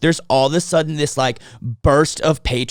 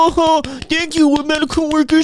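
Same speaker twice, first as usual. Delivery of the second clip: mocking clappers; 11 semitones higher than usual. Mocking neighbours thanking medical workers, high and syrupy. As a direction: gush like a smug balcony clapper.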